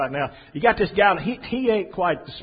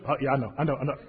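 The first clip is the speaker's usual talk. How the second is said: sheepish backing down; 5 semitones lower, 5 dB quieter, much faster than usual. Acted. Guy backs off after beep.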